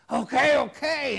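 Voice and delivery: loud, high exasperated okay; mock exasperated surrender; exasperated giving in. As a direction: cave in, fed up. Imitating giving in.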